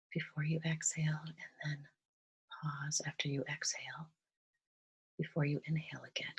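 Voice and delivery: soft, calm meditation voice with long pauses; guided meditation. Real, not acted. Guide leading listeners through breathing and gratitude, softly meditative. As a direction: gently guide them into relaxation, leaving space between phrases.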